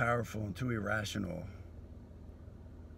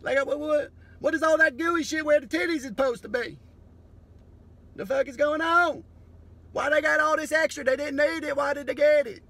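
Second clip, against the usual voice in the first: much higher, louder hammed-up shouting; future species shout; 12 dB louder than usual. Future species finding human remains, high excited shouts. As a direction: call the whole crew over, yelling high. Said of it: "They'll be like".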